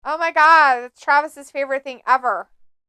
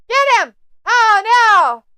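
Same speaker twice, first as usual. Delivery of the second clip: high, loud ringside yell; excited cheer; 8 semitones higher, 7 dB louder, slower than usual. Real, not acted. Fan yelling at the TV match, high and loud. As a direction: shout at the screen.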